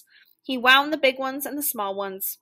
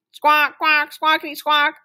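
loud, high rhythmic squawks; raised, punchy horn imitation; tooting taxi horn; 6 semitones higher, 7 dB louder than usual. A narrator's reading. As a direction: honk it out like a taxi. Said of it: "tooted the taxi horn".

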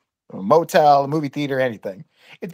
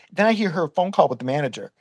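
ordinary delivery

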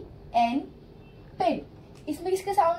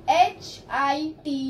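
slow spelling; much slower than usual. Shown as a demonstration. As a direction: spell B I N slowly, letter by letter. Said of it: "hit". (अक्षर-अक्षर धीरे बोलना)

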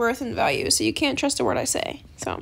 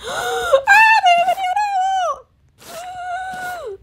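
high, loud excited cry after a gasp; excited squeal; 4 dB louder than usual. Real, not acted. Gamer spots a Neon Owl offered, excited squeal.

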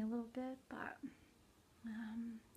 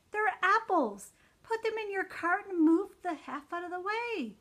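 furious, much higher and louder, shrieking at the shoppers; crazy Walmart rant; 10 semitones higher, 7 dB louder, slower than usual. Real, not acted. Venting at shoppers hogging the aisle, a high furious shriek. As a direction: lose it at the people blocking the aisle. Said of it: "I turn into a crazy person".

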